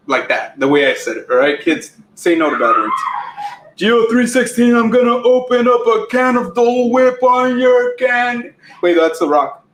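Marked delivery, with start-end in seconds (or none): 3.8-8.5 Stone Cold. Pretend. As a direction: declaim it as a hammed-up promo. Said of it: "I've used The Rock and Stone Cold".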